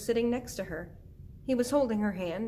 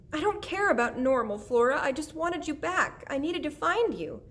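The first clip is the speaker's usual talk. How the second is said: high, loud, distraught outpouring; tearful mother; 7 semitones higher, 4 dB louder than usual. Acted. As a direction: plead through tears as an upset mother. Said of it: "she started to cry again".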